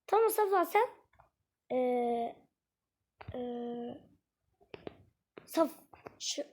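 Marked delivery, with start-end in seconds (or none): none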